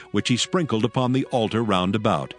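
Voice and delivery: ordinary talk, nothing marked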